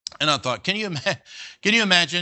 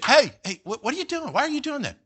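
mock exasperated parent; 10 semitones higher than usual. Parent scolding kids in car.

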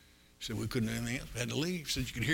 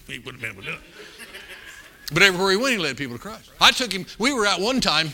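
ordinary talk

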